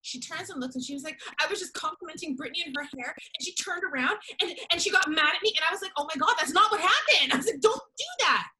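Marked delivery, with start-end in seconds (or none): 1.4-5.5 high-pitched imitation of a crying woman; mock victim, voice pitched up; mock tearful complaint. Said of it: "this girl literally started to cry". 6.0-7.3 high, loud retold objection; indignant reenacted denial; exasperated protest. Retold protest at false accusation. 7.6-8.5 loud, high-pitched retold objection; exasperated reenacted scolding; indignant protest.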